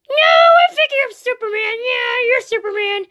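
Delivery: high, squeaky cartoon insistence; high-pitched, starting at a squeal; insistent Toad voice. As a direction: argue in a high puppet voice.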